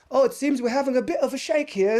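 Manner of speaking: a clumsy New Zealand prime minister voice; shaky attempt at a New Zealand accent; New Zealand accent impression. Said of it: "that's not even the right accent".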